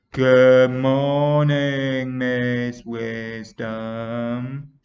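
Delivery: very slow, droning singsong; unenthusiastic class greeting. A narrator's reading. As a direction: drag it out like a bored class in chorus. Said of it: "said Class three unenthusiastically".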